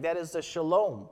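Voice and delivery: usual speaking voice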